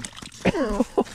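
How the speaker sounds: high-pitched laugh; short, high laugh of disbelief; delighted laugh. Real, not acted. Delighted by fish he caught.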